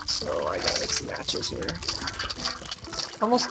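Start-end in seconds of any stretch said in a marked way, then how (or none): 0.1-1.8 low whispered aside; whispering. Real, not acted. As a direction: whisper it to yourself.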